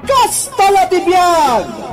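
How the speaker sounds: usual speaking voice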